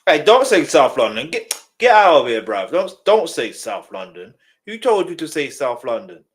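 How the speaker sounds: loud, indignant scolding; irate. Real, not acted. Man objecting to someone saying South London, loud and irate. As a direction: tell them off loudly, outraged. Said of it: "That got me irate".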